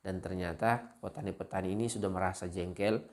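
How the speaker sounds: ordinary talk, nothing marked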